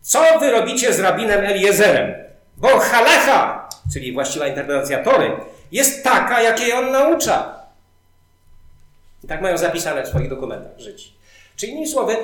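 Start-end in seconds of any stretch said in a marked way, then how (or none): 0.0-3.7 loud, raised, commanding heavenly voice. Retold or imitated. Voice from heaven rebukes the doubters, loud proclamation. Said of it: "odezwał się głos z nieba i powiedział coś takiego". 5.7-7.6 high, emphatic pronouncement; voice from heaven. Retold or imitated. Voice from heaven confirms the rabbi's teaching, emphatic proclamation. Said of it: "odezwał się głos z nieba i powiedział coś takiego".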